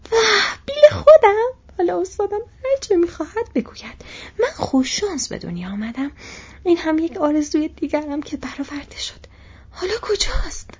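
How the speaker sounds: overjoyed his pony is back; joyful cry. Acted. Sam crying out with joy that his pony Bill is alive. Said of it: "سام فریاد زد".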